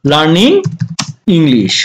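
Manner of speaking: "learning", a clear pause, then "English" falling low; word by word. Shown as a demonstration. Teacher repeating the phrase for learners, word by word. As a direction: separate "learning" and "English", each on its own.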